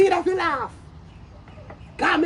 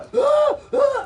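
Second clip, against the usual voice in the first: sharp gasp; 9 semitones higher than usual. Real, not acted. Reacting to the clip.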